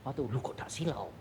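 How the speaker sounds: whispered in wonder; a hushed, awed whisper. Acted. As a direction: breathe it out in amazement. Awed at heaven's light.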